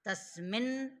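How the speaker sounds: slow, loud recitation of the sutra. Shown as a demonstration. Teacher recites the sutra again for the class, slowly. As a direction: intone it firmly for the class to follow.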